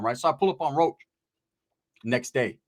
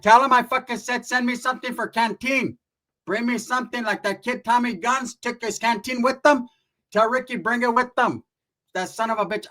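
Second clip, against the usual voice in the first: higher voice of a demanding aunt; imitated aunt; 6 semitones higher than usual. Aunt in the background ordering canteen food, high demanding voice. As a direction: put on a high-pitched relative barging into the call.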